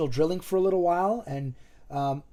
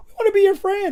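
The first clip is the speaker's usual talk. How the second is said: much higher, light playful quote; high mock friendly voice; 8 dB louder than usual. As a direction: chirp it like a cheerful stranger. Retold friendly approach, high voice.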